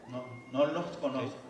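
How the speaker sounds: calm rejection. Acted. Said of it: "Ustedes conocen el texto".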